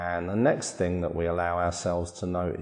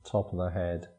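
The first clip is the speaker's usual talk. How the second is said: soft, unhurried body-scan voice with long pauses; guided meditation; 5 dB quieter than usual. Acted. Meditation teacher guiding listeners through a body scan, calm guided meditation. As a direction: calmly guide them through relaxing, phrase by phrase.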